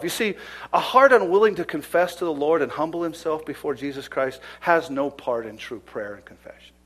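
usual speaking voice

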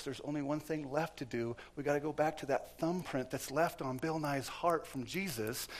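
ordinary talk

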